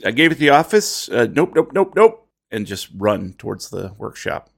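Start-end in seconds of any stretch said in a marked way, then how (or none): 0.1-2.3 panicked. Acted. Player character panics and flees.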